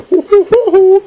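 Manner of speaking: very high hoo hoo hoots; silly hooting. Acted. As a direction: hoot like a giddy angel. Angel body joke.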